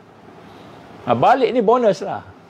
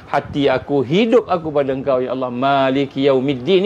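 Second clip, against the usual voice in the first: fervent prayer; 8 semitones lower than usual. Acted. Fighter praying alone the night before battle, low earnest prayer. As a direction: pour out the heart to Allah, low and unhurried.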